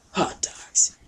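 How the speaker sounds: whispered punchline reveal. Acted. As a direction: breathe the big secret.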